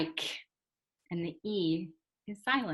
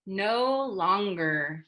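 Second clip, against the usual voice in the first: slow model; slower than usual. Shown as a demonstration.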